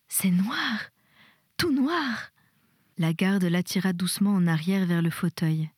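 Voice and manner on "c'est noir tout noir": high, rising cry of dread; frightened insistence. A narrator's reading. Frightened patient tells the nurse what she sees, high-pitched dread. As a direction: voice climbing in panic on the last words.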